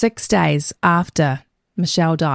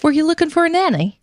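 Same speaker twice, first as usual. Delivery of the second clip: higher, quick voice for the nanny; nanny's offer; 7 semitones higher, faster than usual. Retold or imitated. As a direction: pitch it up, lightly voicing the approaching woman. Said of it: "this woman approaches".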